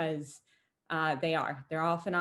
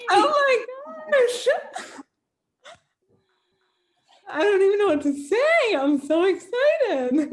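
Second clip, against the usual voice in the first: high, loud and laughing with joy; overjoyed; 8 dB louder than usual. Real, not acted. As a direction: squeal it out, thrilled and laughing. Winner announced, overjoyed.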